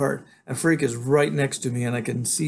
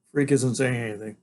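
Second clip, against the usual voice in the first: usual speaking voice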